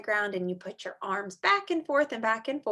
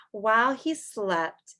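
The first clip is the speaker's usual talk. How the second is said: plain reading voice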